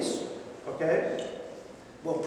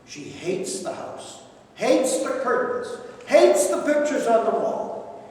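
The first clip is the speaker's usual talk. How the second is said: usual speaking voice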